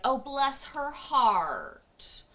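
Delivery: slow, syrupy "bless her heart"; sugary fake politeness. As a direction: drip sweet poison, slowly. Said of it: "weaponize politeness".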